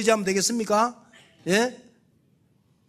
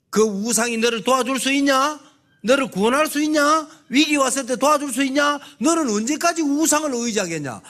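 higher, louder divine rebuke; preaching God's stern rebuke, raised high and loud; God rebuking; 8 semitones higher, 5 dB louder than usual. Acted. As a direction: challenge them as God, voice raised.

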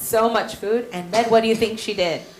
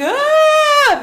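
short high shriek of fright; a sudden high-pitched "Ah!"; retold scream; 14 semitones higher, 6 dB louder than usual. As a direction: scream like the terrified boatmen. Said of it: "They're screaming".

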